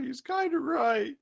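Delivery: high, hammy fake giant. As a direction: play a goofy giant voice. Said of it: "a perfect giant voice calls out".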